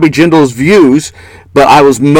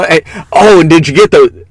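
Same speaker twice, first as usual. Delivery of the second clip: ordinary delivery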